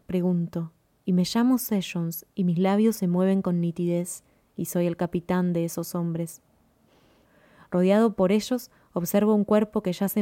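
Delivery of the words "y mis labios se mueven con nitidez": ordinary delivery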